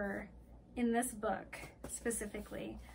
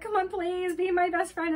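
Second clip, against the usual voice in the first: raised, louder mock begging; pleading; 6 semitones higher, 6 dB louder than usual. Boy begs a girl to be best friends, pleading. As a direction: beg for friendship, playfully pushy. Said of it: "funny and then beg".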